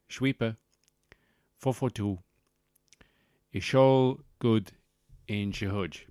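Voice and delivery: choppy fake-Dutch English in short bursts; slushy 'sh' sounds in a parody Dutch accent; mock Dutch accent. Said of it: "talking to them in a Dutch accent".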